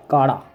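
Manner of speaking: काड़ा with a flapped ड़ for the l of काला; demo pronunciation. Shown as a demonstration. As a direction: flap the ड़ in काड़ा. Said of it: "काला जो होता है ना".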